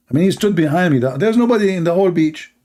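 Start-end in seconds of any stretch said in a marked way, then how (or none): none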